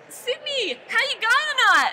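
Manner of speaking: high, loud hammed-up Aussie greeting; fake Australian accent. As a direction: put on a silly Australian accent. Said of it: "really weird fake Australian accent".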